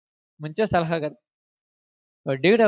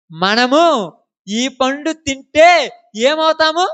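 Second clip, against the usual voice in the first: higher, louder and slow, wondering aloud; quoting Eve; 5 semitones higher, 5 dB louder, much slower than usual. Retold or imitated. Preacher acting Eve eyeing the fruit, slow wondering question. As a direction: stretch the question out like an eager Eve. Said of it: "ఏమన్నదంట".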